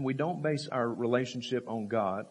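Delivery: normal talking voice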